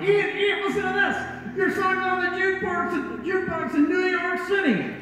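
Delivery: high shouting over jukebox; excited shout. Retold or imitated. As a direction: yell it down the phone. Paul shouting down phone.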